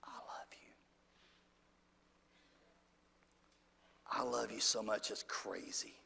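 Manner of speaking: breathy whisper, very soft and low; hushed intimate whisper; whispered love. Pretend. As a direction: whisper it tenderly into their ear. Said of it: "sit beside you and say this to you".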